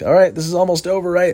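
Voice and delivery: high, nervous inner hope; nervous hopeful self. Retold or imitated. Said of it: "I'm like".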